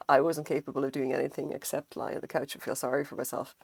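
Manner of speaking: ordinary delivery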